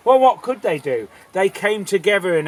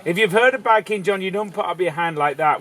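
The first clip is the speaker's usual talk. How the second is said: plain reading voice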